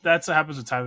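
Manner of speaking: ordinary talk, nothing marked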